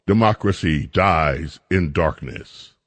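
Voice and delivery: low, slow, mock-solemn intoning; mock solemn motto. Real, not acted. Host mocking the Post's motto, low mock-solemn intoning. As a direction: intone the motto like a portentous announcer.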